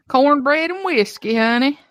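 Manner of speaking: hammed-up country accent. Host joining the joke on herself, hammed-up Southern accent. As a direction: ham it up like a Southern gal.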